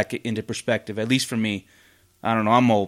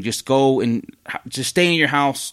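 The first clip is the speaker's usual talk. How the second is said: normal talking voice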